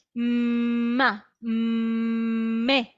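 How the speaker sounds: "ma", "me" with lips closed, humming M; M syllables. Shown as a demonstration. A teacher demonstrating M sounds to parents, slow. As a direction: hum the M into each syllable. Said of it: "decir una M".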